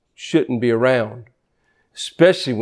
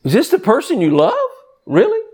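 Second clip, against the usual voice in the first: incredulous; 10 semitones higher than usual. Retold or imitated. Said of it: "I'm like".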